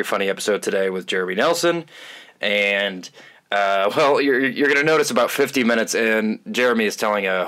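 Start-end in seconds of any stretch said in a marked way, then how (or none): none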